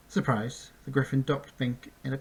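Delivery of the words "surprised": ordinary delivery, nothing marked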